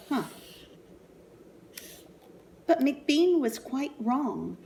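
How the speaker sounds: ordinary delivery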